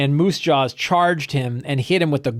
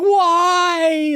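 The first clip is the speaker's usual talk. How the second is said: pitched-up pitiful whimper of a question; tiny rabbit withering away; bunny dying from the spell; high dying rabbit wail; 4 dB louder than usual. Retold or imitated. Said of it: "He's like".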